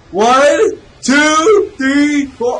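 high, shouted numbers; exasperated loud count. Real, not acted. Gamer counting the TVs, exasperated shouting.

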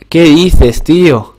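stunned gamer exclaiming loudly; outraged disbelief. Real, not acted. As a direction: protest a shock defeat. Has just lost the round.

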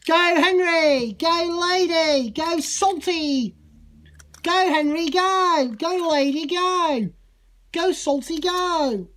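high, drawn-out rallying calls; loud cheering. Pretend. Cheering Henry, Lady and Salty on, loud high calls. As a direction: cheer each one on loudly.

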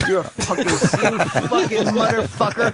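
slow, laughing mock heckle; mock comic rant. Imitating Dice on stage, a slow laughing mock curse. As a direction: drag out the curse like an old comic.

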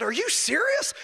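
high, incredulous question; disbelief. Retold or imitated. Driver to police officer, high incredulous disbelief. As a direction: ask it as if you can't believe the ticket. Ticket for three over.

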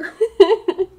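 laughing. Real, not acted. Streamer reacts to fan video.

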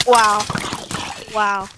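ordinary talk

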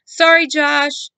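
high, loud, slow call; half-hearted apology called out. A narrator's reading. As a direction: call it after his back. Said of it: "she called to his back".

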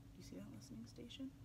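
fast, soft whisper; whispered question. Pretend. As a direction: whisper as if at the listening station. Hushed question to the children.

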